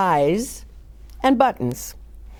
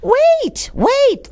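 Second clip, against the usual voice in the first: much higher, louder calling out; old woman's cry; 4 dB louder than usual. A narrator's reading. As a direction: cry after him like a fretting grandma. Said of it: "the old woman cried".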